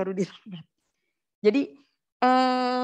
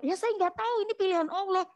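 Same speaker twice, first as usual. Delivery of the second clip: much higher, mock-defensive reply; retold helpless answer; 11 semitones higher than usual. Explaining why she agreed to marry, playful helplessness. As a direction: answer high-pitched, imitating helpless protest.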